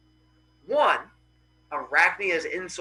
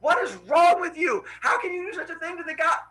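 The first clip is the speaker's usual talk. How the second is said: high-pitched, indignant scolding of an offender; imitated furious telling-off; angry yelling; 11 semitones higher than usual. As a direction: act out an outraged goddess's tirade. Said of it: "while yelling at her".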